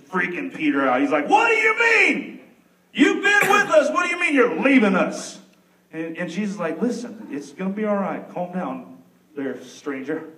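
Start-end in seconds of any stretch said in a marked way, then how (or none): 1.3-5.4 alarmed Peter. Acted. Peter crying out to Jesus about leaving, high and alarmed. 6.8-10.3 calming Jesus. Acted.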